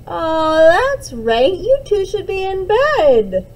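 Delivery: loud, high, mock-stern realization; playful mom. Mom playfully catches the twins still up past bedtime. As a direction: burst out as if you just remembered.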